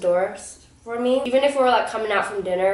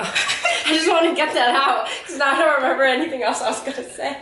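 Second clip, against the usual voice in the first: laughing; 6 semitones higher than usual. Real, not acted. Laughing, flustered by spice.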